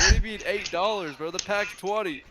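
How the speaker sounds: high, loud disbelief; outraged shout. Retold or imitated. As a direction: yell it in disbelief. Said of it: "I'm like".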